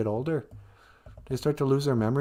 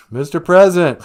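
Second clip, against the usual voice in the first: loud, higher rousing call; 4 semitones higher, 10 dB louder than usual. Acted. Host rousing the silent president, calling out. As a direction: shout to wake someone. Host calls to rouse caller.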